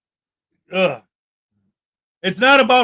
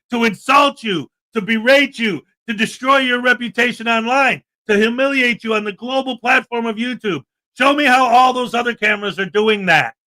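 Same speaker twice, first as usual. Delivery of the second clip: normal talking voice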